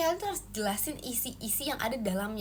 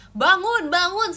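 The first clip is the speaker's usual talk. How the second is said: loud, higher "Bangun, bangun"; loud wake-up call; 5 semitones higher, 10 dB louder, slower than usual. Retold or imitated. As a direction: call them awake like an alarm. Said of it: "kalian pakai suara aku kayak". (teriakan bangun yang keras)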